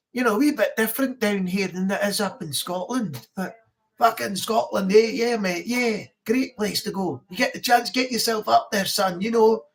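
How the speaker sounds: easygoing Scots, not too strong; lighter Scottish accent. Shown as a demonstration. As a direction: talk like a friendly mild-accented Scot. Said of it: "the lighter Scottish as well".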